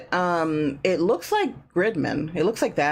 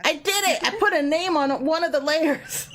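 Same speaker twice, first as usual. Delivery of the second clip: excited; 9 semitones higher than usual. Real, not acted. Proud of naming layers.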